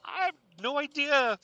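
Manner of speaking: high, loud, slow scared answer; frightened. Acted. Brittany to Samantha, terrified by the voice. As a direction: tremble out the answer.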